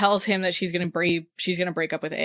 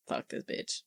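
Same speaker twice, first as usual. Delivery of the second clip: mock whisper, much softer, breathy and voiceless; whispered aside; 16 dB quieter than usual. Narrator voicing Lee's bitter thought in a whisper. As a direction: hiss it under your breath like a secret curse.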